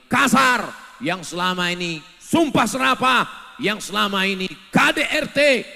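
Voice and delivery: hammered out loud, word by word; loud pulpit denunciation; loud preaching. Acted. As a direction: thunder it at the crowd. Preacher denouncing abusive husbands.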